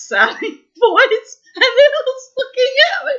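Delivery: squealing high through laughter; laughing hard. Real, not acted. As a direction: still laughing helplessly. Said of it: "We were crying with laughter".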